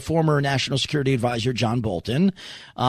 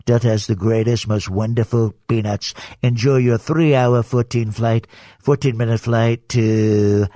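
lower, Trump-style airline announcement; hammed-up Trump on the cabin PA; Trump flight attendant announcement; 3 semitones lower than usual. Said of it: "Donald John Trump".

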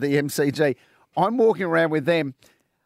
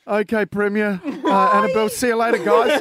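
louder, laughing through the farewell; laughing goodbye; 4 semitones higher, 5 dB louder than usual. Retold or imitated. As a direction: say goodbye while cracking up. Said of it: "turn around and go".

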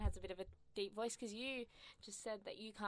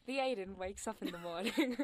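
lightly imitated wildlife narrator; louder, mock documentary narration; a hint of a nature-film voiceover; David Attenborough; 6 dB louder than usual. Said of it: "right there, David Attenborough".

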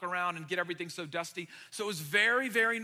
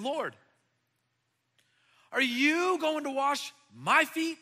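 Peter's shocked question; 6 semitones higher, 6 dB louder, slower than usual. Acted. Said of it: "Peter said to him".

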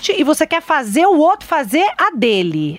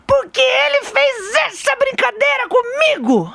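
put-on high, slow, shouted child's complaint; a son crying out his grievance to mom; angry child; 12 semitones higher, slower than usual. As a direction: wail it out like a hurt boy.

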